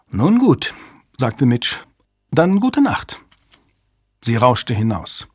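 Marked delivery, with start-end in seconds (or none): 0.1-3.2 high, curt goodbye; clipped woman's farewell. A narrator's reading. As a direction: leave with a sharp goodbye. Mitch leaves coldly.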